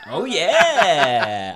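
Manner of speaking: loud, high laugh into a knowing yeah. Real, not acted. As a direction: laugh it out, recognising the place. Laughing reply about Lowell.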